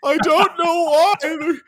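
very high, near-crying squeaky caller; high wailing confused complainer; bewildered caller. Pretend. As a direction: whine like a baffled, tearful 311 caller. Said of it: "caller who was just like".

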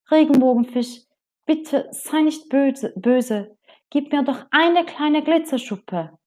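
high, pleading little fish voice; small fish asking the rainbow fish for a scale; little blue fish. Acted.

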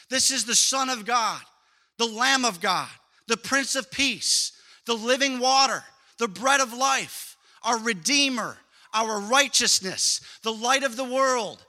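loud, rising litany of titles, slowing near the end; preaching at full force. Acted. Preacher listing the titles of Jesus, loud and building.

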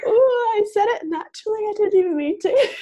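high, loud excited squeal of realisation. Retold or imitated. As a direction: burst out high and thrilled with yourself. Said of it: "I get all excited after".

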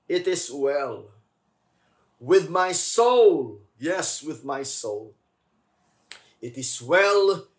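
slow, swelling affirmations; fervent repetition. Acted. Pastor affirming the hymn to the congregation, fervent. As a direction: testify it with conviction. Repeating hymn line with fervor.

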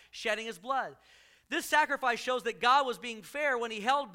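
proclaiming scripture; scripture reading. Acted. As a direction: read the passage out with conviction.

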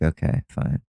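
whispered, grudging okay fine. Retold or imitated. Kid giving in to dad, grudging whisper. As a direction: mutter the okay like a sulky kid. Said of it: "And I was like".

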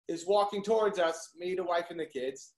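usual speaking voice

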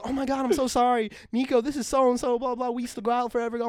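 high-pitched mimicked girlfriend; girlfriend introducing. Girlfriend introducing her old flame, high mock apology.

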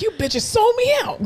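put-on high, slow wounded old man; betrayed old man. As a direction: whine like a betrayed grandpa. Imagined old patient's complaint.

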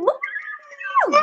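very high celebratory squeal; high whooped "woo". Real, not acted. Host cheers her new co-host, high screamed woo. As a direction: let out a high, thin whoop. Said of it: "I'm screaming, y'all".

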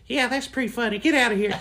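high coach voice; coach's dismissal. Acted. Coach with paddle sending boy out, high-pitched. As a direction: send him off in a high voice.

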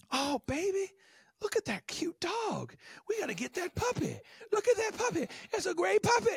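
high, gushing mock wife voice; wife begging for puppy. Imitating a wife pleading with her husband, high and gushing. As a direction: coo over the puppy like an excited wife.

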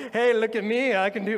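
high, loud, cocky showing off; mock arrogant. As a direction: strut like a know-it-all kid. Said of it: "totally confident and arrogant".

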